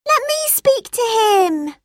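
put-on girl asking her brother for the phone; eager little sister. A narrator's reading. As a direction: beg for the phone like a young girl. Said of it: "said Tim's sister Emma".

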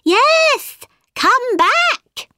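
very high, loud animal chorus voice; animals calling. A narrator's reading. Animals and birds calling the mouse back, high cry. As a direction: call out high, as little creatures. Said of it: "said all the other animals and birds".

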